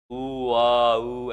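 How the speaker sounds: put-on baby cooing. Shown as a demonstration. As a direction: coo like an infant, drawn out. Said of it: "ऐसे बच्चे आवाज़ निकालते हैं".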